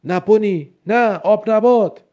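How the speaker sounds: high, loud, slow desperate shout. A narrator's reading. Walter yells to Bonnie as she changes, horrified shout. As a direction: cry out in horror as she transforms. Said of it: "والتر فریاد کشید".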